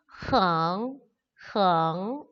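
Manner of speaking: 흥 drawn out, sagging then sliding upward; second tone. Shown as a demonstration. Teacher modelling for learners, 흥 in rising second tone. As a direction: say 흥 slowly as a rising second tone, twice. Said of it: "이성으로 발음을 해주시면 되겠습니다".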